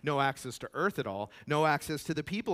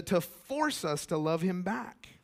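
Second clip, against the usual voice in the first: normal talking voice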